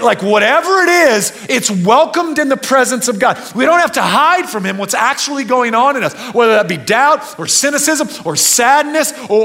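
loud, high, impassioned sermon; preaching at full force. Acted.

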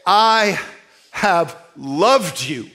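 slow, weighty reading of God's words; slow solemn reading. Acted. Preacher reading God's love to the church, slowly. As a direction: let each word land slowly.

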